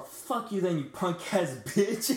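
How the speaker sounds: high, mock-angry hooker voice; mimicked angry insult. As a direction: imitate a rejected hooker cursing him out. Rejected hooker insults him.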